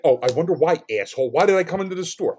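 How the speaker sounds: loud, biting mock wondering; sarcastic comeback. Customer's imagined reply to insurance guy, loudly sarcastic. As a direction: throw the question back with sarcasm.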